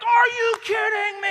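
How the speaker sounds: high, outraged shout; yelling. Retold or imitated. Student blowing it after getting silent lunch, yelling in outrage. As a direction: blow up in loud disbelief. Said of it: "I'm yelling".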